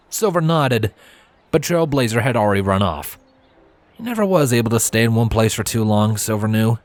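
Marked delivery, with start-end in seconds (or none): none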